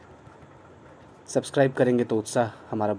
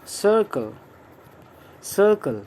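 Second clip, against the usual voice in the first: circle said much higher, a falling model word; 8 semitones higher, 3 dB louder, much slower than usual. Shown as a demonstration. Teacher presents the new word circle, high. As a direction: lift circle up high and let it drop. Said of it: "Next word is".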